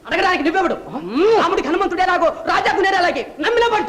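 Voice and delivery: loud, high defiance with a scoffing laugh; defiant retort. Acted. Captive servant defies the villain, loud and scoffing. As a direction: throw the question back at him.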